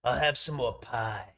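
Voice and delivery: lightly voiced old smoker; a hint of a diner regular. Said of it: "I'm talking like some old smoker".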